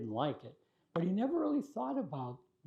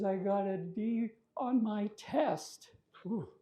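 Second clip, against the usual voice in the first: crying little sister; 6 semitones higher than usual. Retold or imitated. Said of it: "and she's crying".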